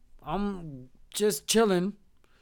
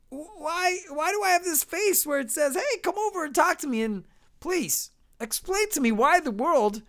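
high-pitched exasperated questioning; mock incredulity; 7 semitones higher than usual. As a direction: protest in baffled, higher voice. Acted disbelief at flat-earther.

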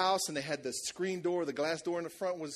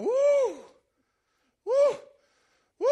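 very high, loud woos; lady's ecstatic woo; 9 dB louder, slower than usual. Retold or imitated. Sick lady on the sofa crying out high woos while prayed over. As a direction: cry out high like the spirit just hit you. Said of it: "the lady starts going".

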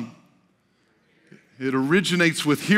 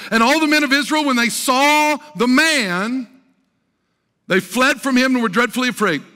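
declaimed scripture reading; 7 semitones higher, 5 dB louder than usual. Real, not acted. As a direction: read the verse out grandly, stretching key words.

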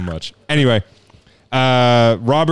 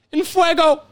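high, punchy sportscaster catchphrase; Dan Patrick. Acted. Host redoes the catchphrase like the great sportscaster, high-pitched announcer call. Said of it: "try to call it, like, the greatest of all time".

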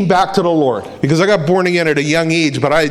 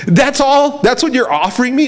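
higher-pitched, unimpressed questioning; mock incredulous; 6 semitones higher than usual. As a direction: scoff at a paltry offer in mock disbelief. Jesus scoffing at the devil's offer.

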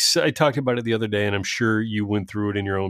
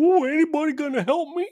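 much higher, fast, whiny pleading voice; pathetic whine; faster than usual. Retold or imitated. Mocking Ted Cruz as a lonely man kicking a can, high whine. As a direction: play a helpless loser begging passers-by.

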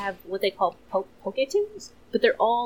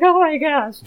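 excited gush; 9 semitones higher, 11 dB louder than usual. Retold or imitated. Retelling gushing over cartoon.

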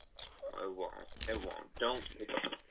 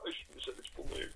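hushed aside; 7 semitones higher, 7 dB quieter, much faster than usual. Real, not acted. Quick embarrassed aside.

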